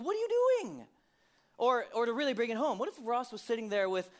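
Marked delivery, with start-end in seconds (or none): 0.0-0.9 much higher, louder mock disbelief; a hammed-up gasp of disbelief; shocked outburst. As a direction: blurt it out like you can't believe your eyes. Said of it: "You're like".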